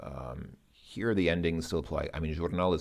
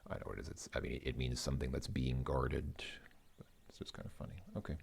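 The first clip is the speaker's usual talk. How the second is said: muttered aside; 5 semitones lower, 10 dB quieter, much faster than usual. Real, not acted.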